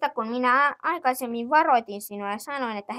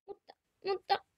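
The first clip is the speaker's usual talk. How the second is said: tearful; 9 semitones higher, 7 dB quieter than usual. Acted. Kids reject, child starts crying.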